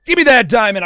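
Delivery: will it to happen, loudly; pleading excitement. Real, not acted. Hunter hoping for a diamond, excited plea.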